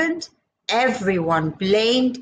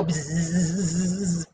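low slow fly buzz; 6 semitones lower, 4 dB quieter than usual. Retold or imitated. Fly buzzing for kids. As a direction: drone it like a fly.

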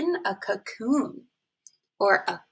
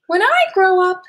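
much higher, bragging caterpillar voice; proud caterpillar; 9 semitones higher, 8 dB louder than usual. A narrator's reading. Caterpillar boasting to the snail, high proud voice. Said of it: "said the caterpillar".

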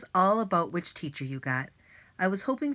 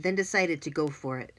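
ordinary delivery, nothing marked

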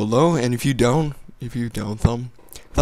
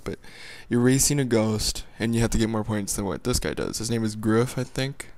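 ordinary delivery

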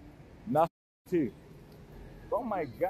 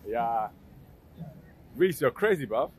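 ordinary delivery

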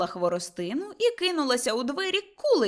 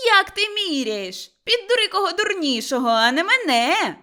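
indignant shout; 8 semitones higher, 6 dB louder than usual. Acted. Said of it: "крикнула Кайдашиха". (обурений високий крик)

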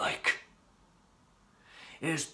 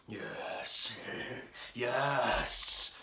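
normal talking voice

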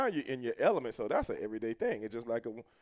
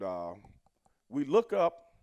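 usual speaking voice

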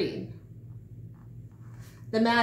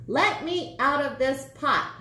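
1 crying out; 6 semitones higher, 4 dB louder than usual. A narrator's reading. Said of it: "she cried".

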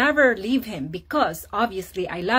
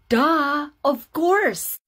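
higher, emphatic obviousness; playful duh; 5 semitones higher, much slower than usual. Retold or imitated. Wife answering husband's needless doubt, playfully emphatic. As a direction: toss it back as blindingly obvious.

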